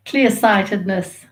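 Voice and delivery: usual speaking voice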